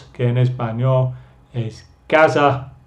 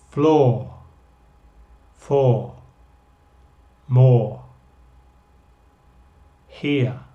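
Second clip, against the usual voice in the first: slow single words with long gaps for the class; listen and repeat; 2 semitones lower, slower than usual. Shown as a demonstration. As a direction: model each word and pause for repeats. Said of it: "Ustedes escuchan y repiten".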